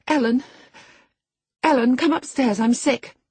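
put-on a frightened girl begging her nurse; distressed girl. A narrator's reading.

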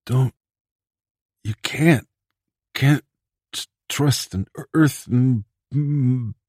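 dying, faltering words; barely forming words. Acted. Shot Bedzik warns them with his last breath, barely forming words. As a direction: struggle to get the words out, fading. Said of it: "barely forming the words".

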